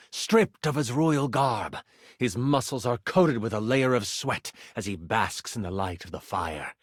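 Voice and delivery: usual speaking voice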